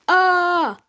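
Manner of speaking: high, loud scream of pain. A narrator's reading. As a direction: scream as claws sink in. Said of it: "screamed Frank".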